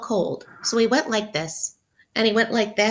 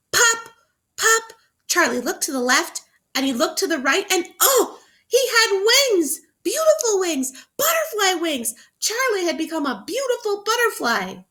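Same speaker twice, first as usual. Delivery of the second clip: even more excited reading; 9 semitones higher than usual. Shown as a demonstration. Teacher rereading the reveal to children, even more thrilled. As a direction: read it with all the wonder you can. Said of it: "I'm gonna read that again".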